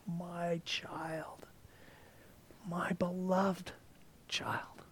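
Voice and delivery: soft whispered words of love; tender whisper. Acted. Jesus holding the believer in his arms, whispering tenderly. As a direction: breathe it out slowly and gently. Jesus embracing, voiced by preacher.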